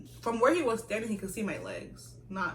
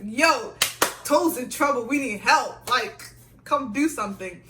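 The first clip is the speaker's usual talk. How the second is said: loud, raised-pitch acted alarm; urgent call for help; 4 semitones higher, 9 dB louder than usual. Friend fetching school father for help, loud and urgent.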